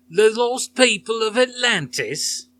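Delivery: mock English accent. Heckler mocking the speaker: loud mock English accent. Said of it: "in a mock English accent".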